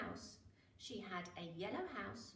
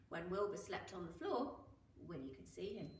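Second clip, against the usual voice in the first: plain reading voice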